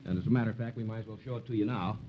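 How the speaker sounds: ordinary talk, nothing marked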